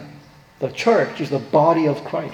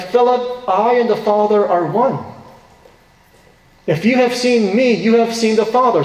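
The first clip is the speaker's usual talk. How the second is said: raised, emphatic quoting voice, pitched well up; voicing Jesus; 6 semitones higher than usual. Pastor voicing Jesus' rebuke to Philip, raised and emphatic. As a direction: preach Jesus' answer with lifted urgency.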